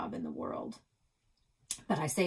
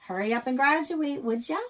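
teasing Nan; 6 semitones higher, 7 dB louder than usual. Retold or imitated. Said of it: "she'd tell me".

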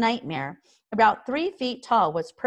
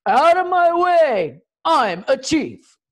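much higher, loud, slow bellowing chief; great cries; 8 semitones higher, 10 dB louder, slower than usual. A narrator's reading. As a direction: shove through the crowd with a booming shout. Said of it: "with great cries of".

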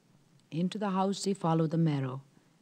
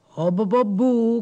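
a big loud exclamation, pitched up; loud cry; 7 semitones higher, 14 dB louder than usual. Acted. Jack cries out loud at the Merrow's words. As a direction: belt it out like a startled fisherman. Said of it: "cries Jack".

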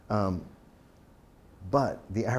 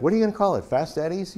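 higher-pitched mock board member's question; raised, incredulous-sounding retold jibe; teasing question; 7 semitones higher, 4 dB louder than usual. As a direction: imitate a board ribbing the boss, pitched up. Board teases about dealership name.

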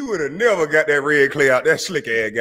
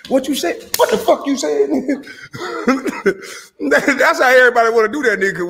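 incredulous outburst; 11 semitones higher than usual. Real, not acted. Incredulous laughing outburst at the podcaster.

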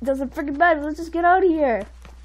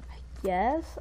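normal talking voice

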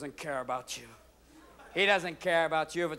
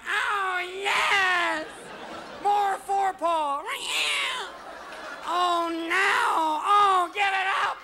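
very high, shouted, ecstatic cat voice; wild high ecstatic shouting; cat in heat; 12 semitones higher, much slower than usual. Acted. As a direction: yowl it out like a cat mid-mating. Cat mating under window.

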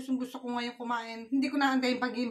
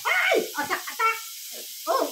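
high, jumpy exclamation; startled yelp; 8 semitones higher than usual. Real, not acted. Vlogger faced with crabs ready to attack, startled yelp. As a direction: yelp high as the crab goes for you.

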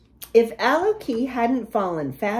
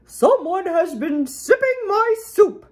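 higher-pitched indignant mama bear; growling mama bear; 8 semitones higher, 4 dB louder than usual. A narrator's reading. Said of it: "she growled".

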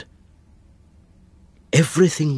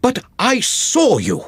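urgent accusation; 10 semitones higher, 4 dB louder than usual. Acted. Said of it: "I said".

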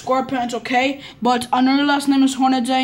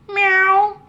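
high, louder pet meow; cat meow; 8 semitones higher, 4 dB louder than usual. Acted. Kid voicing the pet snail Gary, high meow.

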